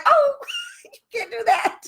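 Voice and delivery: high, laughing squeal of protest; laughing mock protest. Telling colleagues how she reacted, laughing high protest. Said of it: "I was like".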